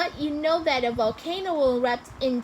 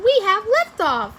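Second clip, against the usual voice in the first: high, loud triumphant call; excited launch announcement; 8 semitones higher, 6 dB louder than usual. A narrator's reading.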